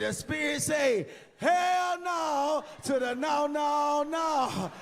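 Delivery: slow, high shouted hell no; preacher shout. Retold or imitated. Comedian mocking the pastor to the church, drawn-out high shout. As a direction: stretch it out like a preacher's holler.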